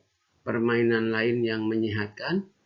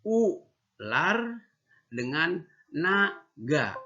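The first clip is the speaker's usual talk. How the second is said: "Ular" rising, then a slow "dengan naga"; two separate words; 3 semitones higher, much slower than usual. Shown as a demonstration. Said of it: "Ini dua kata".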